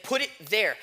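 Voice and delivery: ordinary talk, nothing marked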